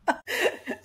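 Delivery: short airy laugh; burst of laughter. Real, not acted. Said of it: "ah, ah, tu rigolais".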